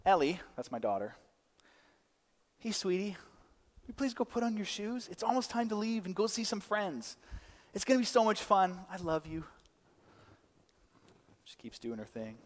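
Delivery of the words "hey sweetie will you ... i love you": soft, higher-pitched, coaxing parent voice; sweet parent. Acted. Dad asking his daughter to get her shoes on, sweetly. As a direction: gently coax a child in a light, soft lilt. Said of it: "It goes something like this".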